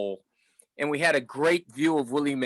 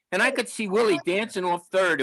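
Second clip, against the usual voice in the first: ordinary talk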